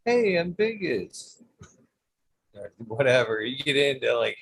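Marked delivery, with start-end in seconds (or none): 0.1-1.4 high, slow mock greeting. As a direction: put on a silly hello. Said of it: "things get me every time".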